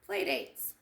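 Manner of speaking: ordinary delivery, nothing marked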